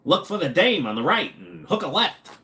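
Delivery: put-on high old-movie mobster voice; nineteen-thirties gangster. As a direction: give directions like a thirties gangster. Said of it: "talking like a nineteen thirties gangster".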